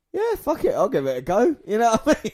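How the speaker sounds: high mock dude voice; stoner dude voice. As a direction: toss it off like a stoner. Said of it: "and you go".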